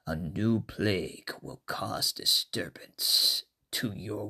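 deep, slow, menacing whisper; ominous low whispering voice; low scary voice. Shown as a demonstration. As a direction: drop low and creep them out. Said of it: "and said in this way".

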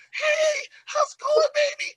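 very high-pitched imitation of a flirty woman; mock sweet woman.

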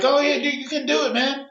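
high-pitched mock cheering-on; goofy high voice of encouragement; egging someone on. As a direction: imitate a dumb onlooker cheering. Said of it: "Encouraging the dumb shit".

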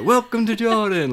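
high, loud, eager welcome; excited greeting. Retold or imitated. Road crew greeting tourists with an excited welcome. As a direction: call out a beaming welcome. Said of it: "they were really excited".

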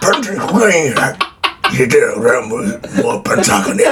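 a lightly voiced Katsu, gruff and low; low mumbling movie star imitation; Shintaro Katsu impression. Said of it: "モノマネオンパレードっすね".

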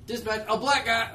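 hammed-up cop on the radio; bad cop impression. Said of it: "I'm a bad cop impression guy".

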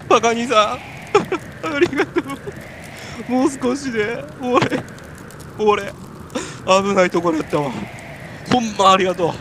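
hammed-up relief at being saved; lightly voiced overwrought thank-you; acted gratitude. Thanks partner after hallucination.

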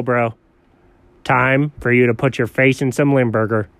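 normal talking voice

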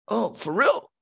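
high, loud mock surprise; surprised uncle. Uncle hears his nephew leaves today, surprised. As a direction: imitate an uncle caught off guard. Uncle reacts to sudden news.

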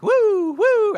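very high whooping magic-trick sound; silly high-pitched abracadabra whoop; magical woo. Pretend. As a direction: make a playful conjuring woo. Miming Jesus producing bread magically.

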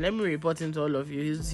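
ordinary talk, nothing marked

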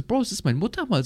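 high-pitched inner protest; mock protest. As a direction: object in disbelief, pitch jumping up. Gedachter Protest gegen die Retusche. (gespielt entrüsteter Protest)

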